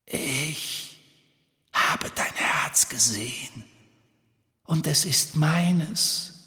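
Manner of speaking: slow whispered hiss. Acted. Locket voice taunting Ron, slow eerie hissing whisper. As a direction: menace him in a hiss. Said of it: "zischte eine Stimme".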